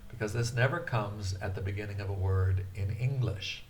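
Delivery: normal talking voice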